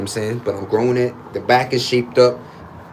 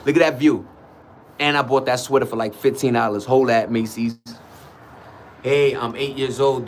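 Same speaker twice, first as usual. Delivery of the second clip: ordinary talk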